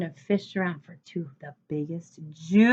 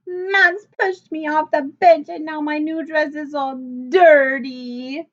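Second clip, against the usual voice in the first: high, loud tearful wail rising at the end; a crying girl tattling through tears; 7 semitones higher, 6 dB louder than usual. A narrator's reading. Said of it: "Chloe wailed".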